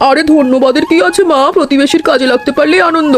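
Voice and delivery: put-on a cheerful older neighbour dropping by; aunt's voice. Aunt brushes off thanks kindly.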